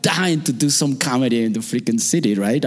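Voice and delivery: ordinary talk, nothing marked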